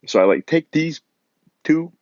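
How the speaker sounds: usual speaking voice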